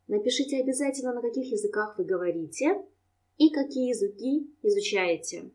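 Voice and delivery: usual speaking voice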